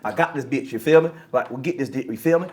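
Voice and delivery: low, loose imitation of local American talk; local down-here accent. Shown as a demonstration. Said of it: "I could talk like y'all down here".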